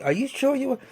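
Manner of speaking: high-pitched double-check; doubtful question. Retold or imitated. Man checking before handing over a piece, doubtful. Acted question back to the requester.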